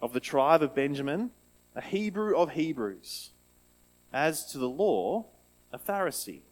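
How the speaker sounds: measured, solemn Bible reading; scripture reading. Acted. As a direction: read the passage with weight. Reads Bible passage aloud.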